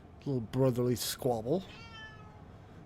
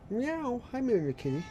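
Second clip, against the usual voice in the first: high playful meow at a cat; 9 semitones higher than usual. Real, not acted. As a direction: mew like a kitten to greet it. Meowing at a cat.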